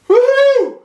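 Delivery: high, loud whooping cheer. Real, not acted. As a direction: whoop at a perfect shot. Celebrating a pure strike.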